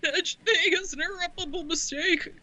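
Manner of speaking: put-on high, cracking teenage boy squeak; squeaky-voice teenager. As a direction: do a squeaky cartoon teen. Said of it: "trying to do squeaky voice teenager".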